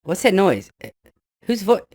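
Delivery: usual speaking voice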